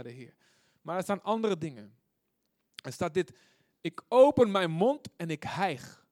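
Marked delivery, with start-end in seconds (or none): 3.8-6.1 loud, slow and raised; emphatic psalm declaiming. Acted. Preacher quoting a psalm about longing, emphatic. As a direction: proclaim it slowly with force.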